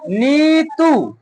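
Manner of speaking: नीतू with the ई and ऊ drawn out very long; teacher spelling नीतू aloud for the class, stretching every vowel; stretched long vowels. Shown as a demonstration. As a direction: sing out नीतू slowly, holding each long vowel. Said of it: "हमने जोर से बोला नी".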